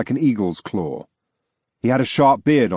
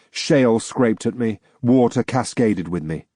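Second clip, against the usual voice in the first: ordinary delivery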